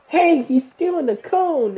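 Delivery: high, loud mock shout; retold scream. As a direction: call out a false alarm like a teasing dad. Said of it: "He screams out".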